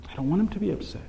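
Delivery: soft, inward aside; hushed repeat. Real, not acted. Preacher repeating his reason not to sin, hushed. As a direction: say it quietly, as to yourself. Same thought repeated softly.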